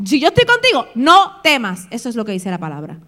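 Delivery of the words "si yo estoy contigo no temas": high, forceful insistence; commanding. Acted. Preacher voicing God to the fearful, forceful command. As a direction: drive it home as a direct order. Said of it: "Es una orden, es un mandato".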